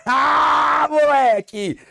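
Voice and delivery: high, drawn-out shout of victory; triumphant cheer. Real, not acted. Teacher celebrates solving the exercise.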